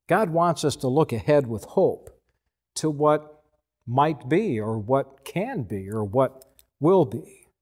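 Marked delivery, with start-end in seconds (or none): none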